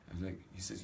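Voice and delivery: usual speaking voice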